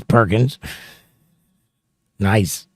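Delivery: normal talking voice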